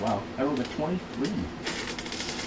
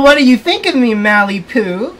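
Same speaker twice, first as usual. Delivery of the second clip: a touch of giddy delight; mildly gushing bride; bursting out happily; 9 semitones higher, 6 dB louder than usual. Acted. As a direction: twirl into it, show off the gown. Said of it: "She bursts out, twirling happily".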